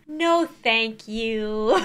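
drawn-out, very slow refusal; slow no thank you. Acted. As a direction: stretch it out slowly. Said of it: "Scary TikToks that make you go".

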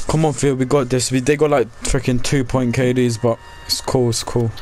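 usual speaking voice

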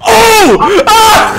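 sudden high shriek; startled yell. Real, not acted. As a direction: yelp in shock. Startled in-game moment.

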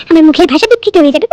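normal talking voice

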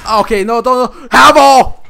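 loud high panicked scream; panicked yell. Real, not acted. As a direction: scream as he gets hit. Havel attacking him.

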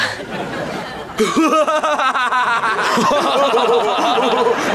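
long hammed-up villain cackle; evil laugh. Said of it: "Ahora una risa malvada".

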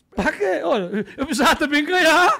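loud, high comic objection; hammed-up quarrelling voice; heated protest. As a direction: protest shrilly with laughter creeping in. Wife argument acted out, loud.

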